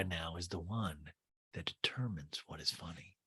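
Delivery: soft unvoiced whisper; whispered aside. Real, not acted. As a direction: whisper it sarcastically. Sarcastic aside.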